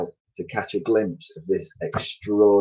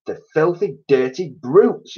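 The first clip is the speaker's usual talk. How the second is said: angry yell; 9 semitones higher, 4 dB louder than usual. A narrator's reading. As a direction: snarl it out at the birds. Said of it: "yelled Mister Twit".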